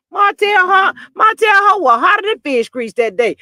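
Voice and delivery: hollering about him; loud exclaim. Real, not acted. Vlogger hollering about Martell being mad.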